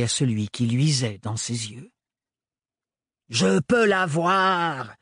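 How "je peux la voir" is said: taunting. Acted. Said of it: "le nargua le sans-peur".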